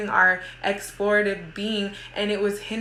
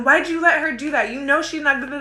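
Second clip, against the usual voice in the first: louder, higher angry scolding; angry yell; 7 semitones higher, 5 dB louder than usual. Retold or imitated. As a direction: snap at the other daughter in a rage. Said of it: "And then I yell at the other one".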